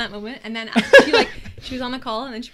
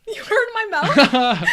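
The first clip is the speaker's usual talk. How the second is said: slow, high, loud through laughter; incredulous laughing question; 8 semitones higher, 10 dB louder, much slower than usual. Real, not acted. Amused by whisper remark.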